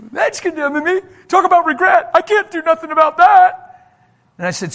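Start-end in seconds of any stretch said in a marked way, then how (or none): 0.1-3.6 high, loud mock tirade; hammed-up indignant shrieking; letting someone have it. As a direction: act out an upset woman yelling back. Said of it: "started letting me have it".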